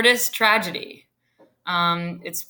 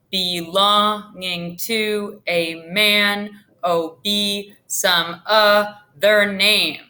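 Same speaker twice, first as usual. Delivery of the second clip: slow, every syllable beaten out; rhythm tapped out; 2 semitones higher, much slower than usual. Shown as a demonstration. Teacher slowly tapping out the line's rhythm for students. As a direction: tap out "Belonging to a man, oh, be some other name" syllable by syllable, slowly. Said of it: "tap out that, that rhythm".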